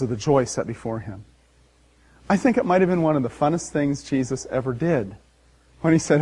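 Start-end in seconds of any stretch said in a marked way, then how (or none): none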